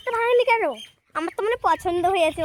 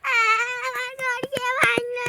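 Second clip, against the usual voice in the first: high, drawn-out tearful wail; crying; 6 semitones higher, slower than usual. Real, not acted. Girl finds her play hut broken, crying. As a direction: wail it out slowly through crying. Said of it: "কাঁদিস না, কাঁদিস না".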